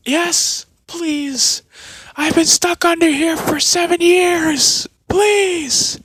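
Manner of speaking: very high, loud pleading; begging Naruto. Acted. Naruto begs the old warrior to free him, high pleading. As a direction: plead desperately like a trapped boy.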